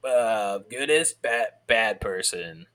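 slow, solemn lecturing voice; mock cop lesson. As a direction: deliver the moral slowly.

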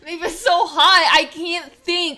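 loud, high, drawn-out whine; overwrought whining. Acted. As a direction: wail about the heat as an excuse. Complains heat stops her thinking.

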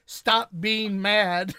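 mimicked bossy small child; imitated young daughter. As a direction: draw it out like a little kid ordering Dad. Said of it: "The way you said it is her".

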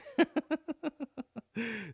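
high burst of giggling; high-pitched chuckle; laughing. Real, not acted. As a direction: laugh helplessly at your own dig. Laughs at own joke about friend.

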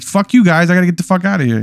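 acted hurry to get away; flustered brush-off. Player telling the twins off before the date, mildly frantic. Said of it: "And so I'm like".

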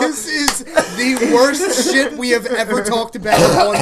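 loud, high, through real laughter; laughing. Real, not acted. Host reacting to the grim reading, laughing hard. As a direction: barely get it out while cracking up.

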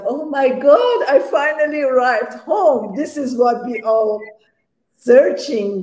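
high, loud rush of joy with a laugh; overjoyed exclamation. Retold or imitated. Recalling her near-death arrival home, joyful. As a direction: gush like finally coming home.

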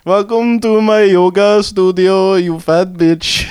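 put-on slow, drawn-out Indian accent; imitation yoga guru, unhurried Indian accent; mock guru welcome. Acting Bikram at his studio.

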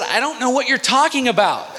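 high-pitched protest, imitating the kid; desperate bafflement. Beaten kid answers his attacker, acted desperate bafflement. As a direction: protest high, pleading ignorance.

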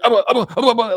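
high, loud, mocking gibberish; mock babbling interrupter. Mocking the debater who kept interrupting, high babble. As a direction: drown him out with silly babble. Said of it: "He's like".

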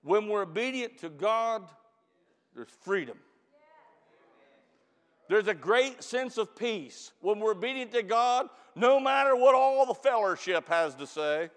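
fervent closing exhortation; preaching. Acted. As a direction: bring the sermon home.

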